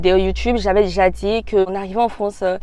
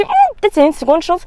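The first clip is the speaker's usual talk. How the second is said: hopeful musing; 9 semitones higher than usual. Retold or imitated. Acts out her hopeful thought.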